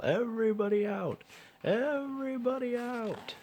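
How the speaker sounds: high, long called-out order; lifeguard calling. A narrator's reading. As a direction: call it out across the beach.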